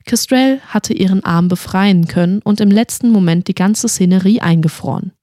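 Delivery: plain reading voice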